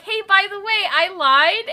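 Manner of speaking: high, loud, falsely casual announcement; mock breezy confession. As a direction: pretend to drop a confession brightly. Imagined breezy confession to mom.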